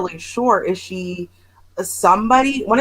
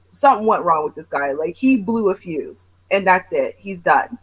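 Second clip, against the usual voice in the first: ordinary delivery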